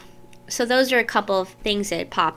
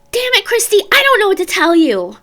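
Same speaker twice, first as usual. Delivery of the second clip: high, loud, fed-up mother snapping; exasperated mom; 12 semitones higher, 10 dB louder than usual. Retold or imitated. Mom answering crying daughter while smoking, loud and exasperated. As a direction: imitate a frustrated mom at her wits' end. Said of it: "that's literally how she was".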